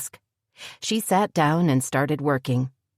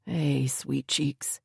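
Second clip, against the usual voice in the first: put-on soft slow whisper to the baby; hushed whisper to a baby; murmur; 2 semitones lower, 7 dB quieter, much slower than usual. A narrator's reading. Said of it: "he murmured".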